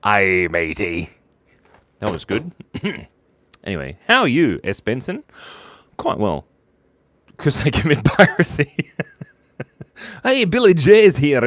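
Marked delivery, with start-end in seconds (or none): 0.0-1.1 low, slow pirate "aye, matey". Acted. Answering the pirate birthday joke, slow low pirate voice. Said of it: "What did the pirate say". 10.2-11.4 high, loud hail to the crowd; excited announcement. Real, not acted. Host announcing a viewer's arrival, loud and excited.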